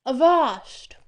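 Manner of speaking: big hammed-up cry; battle cry. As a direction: call it out like a challenge in a story. Voices a character's battle cry.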